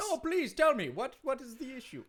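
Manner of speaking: hammed-up Jamaican accent; Kofi Kingston, Jamaican accent. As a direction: greet them in a mock Jamaican lilt. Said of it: "you sound like you're from someplace else".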